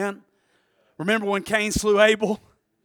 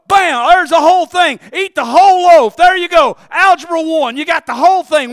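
loud, high, punchy preacher delivery; raised-voice mock announcement; mock big reveal; 8 semitones higher, 11 dB louder than usual. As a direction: belt it out like an instant giveaway. Mocks instant understanding in the sermon.

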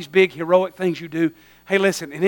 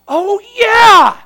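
much higher, loud shouted remembering; excited shout; 13 semitones higher, 10 dB louder, slower than usual. Retold or imitated. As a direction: burst out with sudden recall. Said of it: "that butler goes".

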